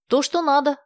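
high, loud burst of delight; exclaiming. A narrator's reading. Nadezhda hears the neighbor is a widower, exclaims with delight. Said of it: "воскликнула Надежда".

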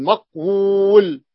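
مقول stretched slowly to show the long vowel; مقول drawn out slowly; drawn-out maqul. Shown as a demonstration. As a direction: linger on مقول to show its shape. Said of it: "لأن لدينا مدان".

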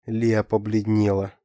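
ordinary delivery, nothing marked

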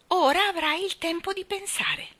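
high-pitched, lightly mocking remark; airy, ironic parting shot. A narrator's reading. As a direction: needle the child with mild irony. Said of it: "osservò in tono ironico".

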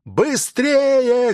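high, drawn-out distant-style cry; urgent high-pitched yell, long vowels; shouted warning. A narrator's reading. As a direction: call out slowly and high to make them run. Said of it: "кричал он, размахивая руками".

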